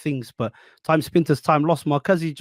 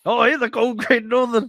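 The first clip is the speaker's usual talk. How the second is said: beer ad voice; 7 semitones higher, 3 dB louder than usual. Pretend. Mock beer ad voice.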